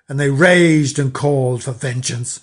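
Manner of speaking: ordinary delivery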